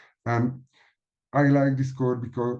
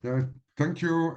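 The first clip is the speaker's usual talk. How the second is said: usual speaking voice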